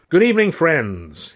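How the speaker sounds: high, loud Southern orator; booming, smiling speechmaker; mayor's address. Acted. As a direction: welcome the crowd like a beaming mayor. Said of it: "he began with a huge smile".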